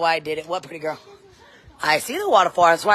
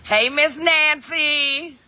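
sing-song call; 8 semitones higher, much slower than usual. Real, not acted. Mom calls sing-song to someone.

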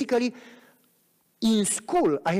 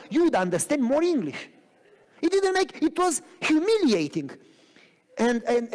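ordinary talk

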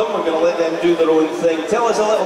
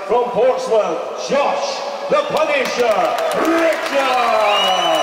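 much higher, slow, stretched fighter-name call; drawn-out ring name shout; 5 semitones higher, much slower than usual. Acted. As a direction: belt the names out to the arena. Announcing boxer into ring.

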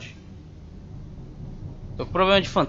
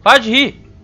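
loud, higher scolding at the laughter; scolding shout; 8 semitones higher, 10 dB louder than usual. Real, not acted. Player scolds the laughing ghost, loud. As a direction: tell the ghost to stop laughing.

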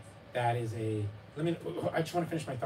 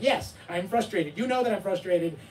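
put-on exasperated child; 7 semitones higher, 5 dB louder than usual. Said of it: "He'd be like".

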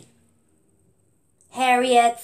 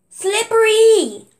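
high, drawn-out excited call; hollering in a forest; 8 semitones higher, 4 dB louder than usual. Acted. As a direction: call the name out across the woods. Child calling out toy names.

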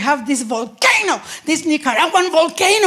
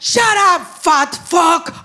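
ordinary talk

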